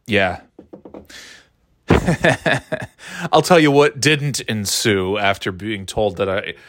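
ordinary delivery

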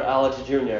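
normal talking voice

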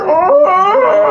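loud high-pitched "Oh!"; loud high yelp. Retold or imitated. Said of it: "He's like".

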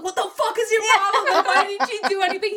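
high, loud, half-laughing outburst; mock angry yell. Retelling a fight with her boyfriend, high laughing mock yell. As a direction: yell at him, laughing through the anger.

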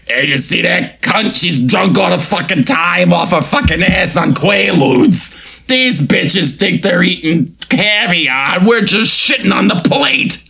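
a hint of a Goodfellas mobster; lightly done Goodfellas tough guy; light mobster accent. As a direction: sneer it like a wannabe wiseguy, lightly. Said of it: "He's not an extra in Goodfellas".

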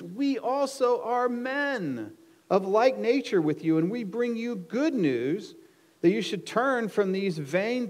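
loud, high appeal to a crowd; crying out. Acted. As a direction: proclaim it urgently, like an apostle stopping a crowd. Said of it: "crying out".

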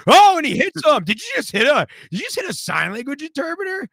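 high, loud excited play-by-play; fight commentary. Retold or imitated. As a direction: call the punch like a ringside announcer. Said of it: "you were like".

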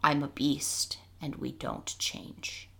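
quiet, slowing coldly to the end. A narrator's reading. Nick Brick declares his beastly nature to the others, cold. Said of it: "in a cold voice".

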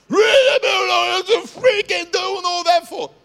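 very loud, very high ranting yell; furious shout. Acted. Acted spouse losing their temper at home, furious high yell. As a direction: explode at your partner.

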